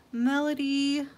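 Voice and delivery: ordinary delivery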